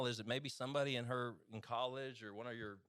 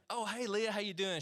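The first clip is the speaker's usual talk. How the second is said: bright, higher eager hello to a crush; eager greeting; 11 semitones higher, 4 dB louder than usual. Retold or imitated. Husband recalling greeting his future wife, eager and much higher. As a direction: call out hopefully, a lot higher and a bit louder. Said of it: "I just remember being like".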